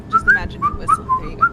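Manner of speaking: goofy high-pitched coaching; squeaky mock whistle.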